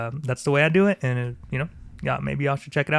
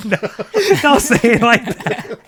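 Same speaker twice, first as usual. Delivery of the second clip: laughing protest; 10 semitones higher, 7 dB louder than usual. Real, not acted. Friend objecting to teasing about genes, laughing protest. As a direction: protest while cracking up.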